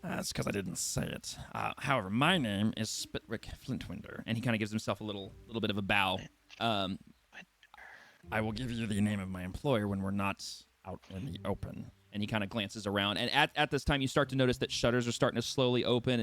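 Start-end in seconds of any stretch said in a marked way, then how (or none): 0.0-4.2 low, soft, smooth-talking gnome; a low sly gnome pitching a job; gnome recruiter voice. Acted. Gnome recruiter coaxing the party, low and soft. 8.3-11.9 low, soft, smooth-talking gnome; a low sly gnome pitching a job; gnome recruiter voice. Acted. Gnome recruiter coaxing the party, low and soft.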